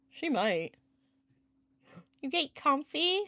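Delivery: high, soft, slow cooing at a pet; sweet pet-talk voice. Real, not acted. Cooing to her cat.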